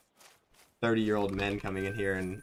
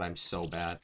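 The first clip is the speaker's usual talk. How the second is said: normal talking voice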